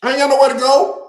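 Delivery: loud, high carefree shout of a partying fan; rowdy fan. Retold or imitated. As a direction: yell it like you'll party all night. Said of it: "Them boys out there".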